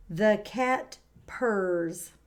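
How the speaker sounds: very slow, each word apart; drawn out for the child to repeat; slow model sentence. Shown as a demonstration. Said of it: "My turn".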